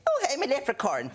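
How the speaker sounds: put-on high-pitched hammy old-fashioned Irish accent; bad stage-Irish leprechaun brogue. Shown as a demonstration. Comic showing the audience his bad accents, old-fashioned Irish. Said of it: "really old-fashioned sort of Finny".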